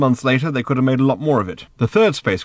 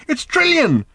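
high, loud excited exclamation; shouting; 6 dB louder, slower than usual. Acted. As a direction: yell the discovery to the next room. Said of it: "shouted Arthur".

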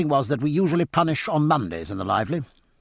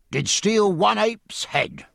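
a high, slow, official announcement of Did steal one ape's head; a master-at-arms reading out charges; hoarse official; 9 semitones higher, slower than usual. Acted. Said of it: "speaking hoarse and official".

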